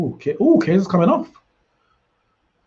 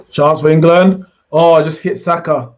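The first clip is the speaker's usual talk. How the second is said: excited shout; 6 semitones higher, 8 dB louder than usual. Real, not acted. Cheering a chance in the match.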